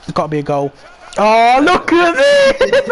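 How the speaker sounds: normal talking voice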